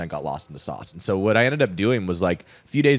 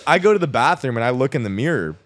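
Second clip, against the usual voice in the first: laughing; 5 semitones higher, 5 dB louder than usual. Real, not acted. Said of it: "laughter continues".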